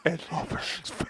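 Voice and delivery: very soft voiceless whisper; teasing whisper. Pretend. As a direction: breathe it softly into their ear. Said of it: "I don't want you whispering".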